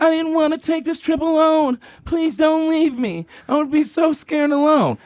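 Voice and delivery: high, whiny crying imitation; mock sobbing. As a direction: wail it like a hammed-up crybaby. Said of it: "So she starts crying".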